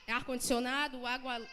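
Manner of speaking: usual speaking voice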